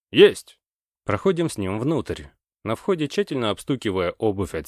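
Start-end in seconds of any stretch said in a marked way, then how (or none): 0.1-0.6 high, loud military acknowledgment; sharp loud "yes sir". A narrator's reading. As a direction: snap it out like a soldier. Soldier's acknowledgment.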